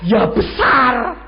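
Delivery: slow, loud, stretched besar; emphasised big. Acted. Stressing how big it seemed.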